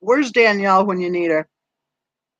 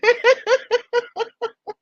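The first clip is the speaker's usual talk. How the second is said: high laugh; 14 semitones higher than usual. Real, not acted. Laughs at viewer's comment.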